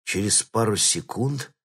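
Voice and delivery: plain reading voice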